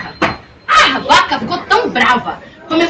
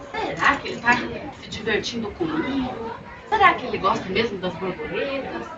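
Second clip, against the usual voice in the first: quiet wondering; 3 semitones lower, 9 dB quieter than usual. Acted. Girl wonders quietly to herself.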